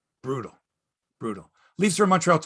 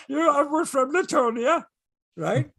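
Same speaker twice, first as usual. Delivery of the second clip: put-on higher, Eastern European Schmengi accent; Stan Schmengi accent; 10 semitones higher than usual. Mocking the goalie's interview, higher Schmengi-style accent. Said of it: "he sounds like ... Stan Schmengi".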